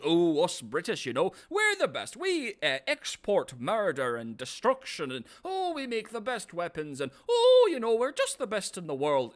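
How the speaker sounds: high, loud, pompous mock brag of an Englishman; mock British bragging. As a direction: ham up a smug colonial boasting about his empire. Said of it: "And you're going around".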